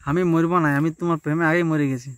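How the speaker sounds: usual speaking voice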